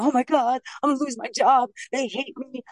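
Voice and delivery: half-playful imitation of freaking out over losing the job; mock panic. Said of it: "I would have been".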